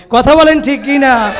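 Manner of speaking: loud, high call for agreement; rallying shout. Real, not acted. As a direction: shout to rouse the crowd into answering. Demands response from the crowd. (জোর গলায় সমর্থন চাওয়া)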